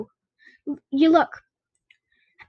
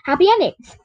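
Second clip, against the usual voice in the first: ordinary delivery, nothing marked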